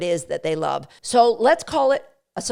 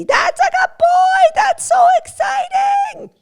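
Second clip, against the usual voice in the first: very high, loud, delighted shout of praise; celebrating; 8 dB louder than usual. Shown as a demonstration. Trainer celebrating as the dog grabs the cookie, ecstatic high shout. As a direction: burst out in over-the-top joy, slowing on the praise. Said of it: "We're going to celebrate".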